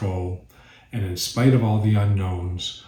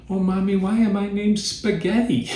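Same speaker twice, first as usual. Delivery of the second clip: put-on much higher, innocent kid voice; little child voice; 11 semitones higher than usual. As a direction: squeak it out like the tiniest child. Youngest child asking in joke.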